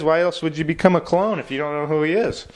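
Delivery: ordinary delivery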